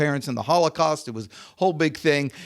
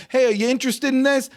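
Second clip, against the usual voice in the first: quick, higher-pitched casual pitch; breezy sales patter; 9 semitones higher, faster than usual. Acted. Coach acts out a cold call to a prospect, breezy and quick. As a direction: toss the question off fast and light.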